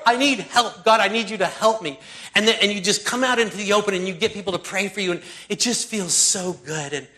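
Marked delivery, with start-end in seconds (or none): 0.1-2.0 loud, higher plea to God. Retold or imitated. Acting out a desperate plea.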